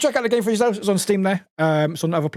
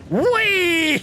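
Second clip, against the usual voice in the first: high gleeful whee; mock whee; 13 semitones higher, 4 dB louder than usual. Charlie going high gleeful whee while moving it. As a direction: squeal it like a kid on a ride. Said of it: "Charlie's just moving this, going".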